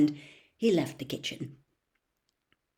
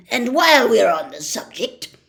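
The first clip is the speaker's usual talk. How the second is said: louder bullying tone; loud threatening uncle; 5 semitones higher, 11 dB louder than usual. A narrator's reading. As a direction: bark it at the boy. Vernon threatening Harry.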